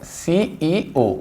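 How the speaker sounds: सीईओ said very slowly and softly; drawn-out सीईओ for students; soft slow demo. Shown as a demonstration. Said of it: "क्या होते हैं". (धीमा, नरम उच्चारण)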